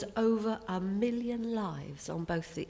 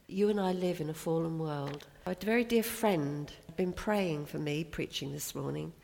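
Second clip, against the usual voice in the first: normal talking voice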